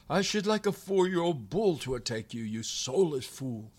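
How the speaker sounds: ordinary delivery, nothing marked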